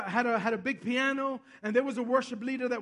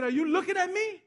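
raised pitch, pointed question; confrontational challenge; 6 semitones higher than usual. Retold or imitated. Little pastor to a big guy on a train, confrontational challenge. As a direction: imitate someone calling out a stranger. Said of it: "he talks to a big guy like Adam".

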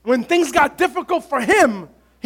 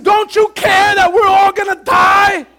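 high, loud and slowed accusation; slow frantic shout; 6 semitones higher, 5 dB louder, slower than usual. Retold or imitated. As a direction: demand an answer, shouting. Disciples confronting Jesus.

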